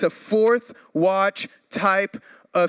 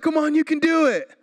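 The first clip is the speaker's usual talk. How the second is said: much higher, coaxing call; eager new dad; 11 semitones higher, 3 dB louder than usual. Retold or imitated. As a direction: cheer on tiny kids by the pool. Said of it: "I'm like".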